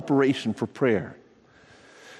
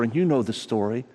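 ordinary talk

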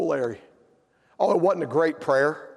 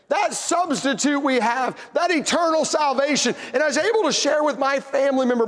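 higher, louder sermon climax; preaching at full force; 10 semitones higher, 7 dB louder than usual. Acted. Preacher to congregation about Uncle Larry, preaching at full force.

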